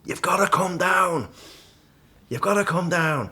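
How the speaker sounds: high-pitched yell, stretched out slowly; imitated scream. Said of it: "screaming at me".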